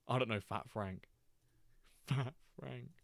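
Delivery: words squeezed out through laughter; giggling at the name; laughing. Real, not acted.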